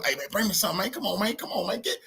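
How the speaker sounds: mock fiend whining for a fix; begging junkie. Mimicking a user begging his dealer for drugs, soft and pleading. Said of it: "y'all know how they go".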